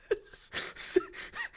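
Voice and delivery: high, voiceless wheezing laugh; helpless laughter. Real, not acted. Reader losing it at his own accent, wheezing laughter. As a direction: crack up completely, real laughter.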